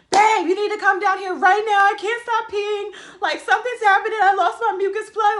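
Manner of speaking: high, loud, tearful panic, half laughing; calling for help through tears; panicked crying call. Retold or imitated. Frightened girlfriend crying out to boyfriend to come down. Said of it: "Like, I'm freaking out".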